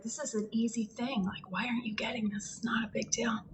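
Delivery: whispered impatient local; breathy whisper, mildly impatient. Retold or imitated. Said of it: "the person on the other side is like".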